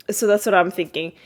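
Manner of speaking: normal talking voice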